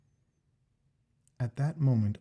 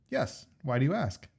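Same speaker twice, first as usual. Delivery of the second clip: higher, eager Jack; Jack's voice; 4 semitones higher than usual. Acted. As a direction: voice the keen friend Jack, pitched up. Jack's voice in story.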